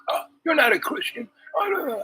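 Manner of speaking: very high-pitched imitated disdain; mock scoffing. Woman dismissing the storyteller's faith, mock scoffing.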